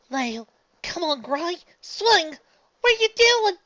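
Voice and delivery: building to a high loud yell; frustrated cry. Acted. Apple Bloom urging the batter to swing, rising yell. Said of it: "Apple Bloom cried".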